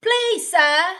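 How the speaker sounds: high, loud pleading British waif; put-on British accent. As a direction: beg in a hammed-up British accent. British orphan impression after accent talk.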